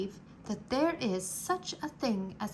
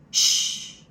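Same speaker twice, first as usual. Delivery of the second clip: loud sharp shush; sharp hush; 8 dB louder than usual. Acted. Threadmaster hushing.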